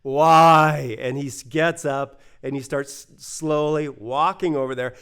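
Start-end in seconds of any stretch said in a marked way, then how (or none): 0.0-0.9 a grumbling, louder why; angry driver. Retold or imitated. The woken bus driver protesting, louder and annoyed. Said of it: "he just is angry".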